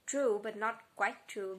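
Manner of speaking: ordinary delivery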